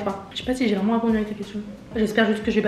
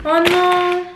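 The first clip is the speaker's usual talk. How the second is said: disappointed; 7 semitones higher, much slower than usual. Real, not acted. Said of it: "je suis dégoûtée".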